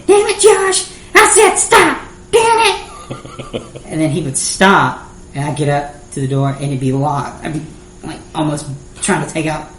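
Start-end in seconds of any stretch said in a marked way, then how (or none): 0.1-3.6 high, loud yell after someone driving off. Retold or imitated. Kid chasing friend's car through the parking lot, yelling. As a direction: holler it like a frantic, chasing kid. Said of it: "yelling at him".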